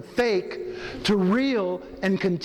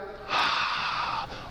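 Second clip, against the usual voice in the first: long audible sigh out; deep sigh out; 5 dB quieter than usual. Shown as a demonstration. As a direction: let the breath go with a sigh. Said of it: "Let it out".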